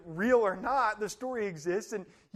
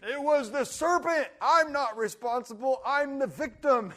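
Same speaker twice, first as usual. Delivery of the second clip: higher, louder whiny protest; Eve playing victim; 4 semitones higher, 6 dB louder than usual. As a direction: wail that it isn't her fault. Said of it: "And she goes".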